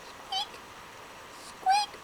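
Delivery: very high little squeak; mouse squeak. Acted. Little mouse squeaks to the trapped lion.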